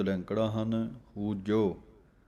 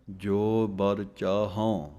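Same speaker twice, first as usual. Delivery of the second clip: ਜੋ, a short pause, then ਬਰ ਚਾਹਉ, slow; pause after ਜੋ; much slower than usual. Shown as a demonstration. Gurmukhi teacher shows students the pause: ਜੋ ... ਬਰ ਚਾਹਉ slow. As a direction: hold a beat after ਜੋ, don't join ਜੋਬਰ. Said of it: "ਜੋ ਦੇ ਉੱਤੇ ਥੋੜ੍ਹਾ ਜਿਹਾ ਰੁਕਣਾ ਹੈ".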